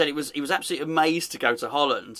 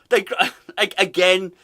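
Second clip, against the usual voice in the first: normal talking voice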